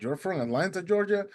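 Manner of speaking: disbelieving question, rising high on Georgia; retold surprise. Boatman learns swimmer is from Atlanta, astonished question. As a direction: echo it back in acted amazement.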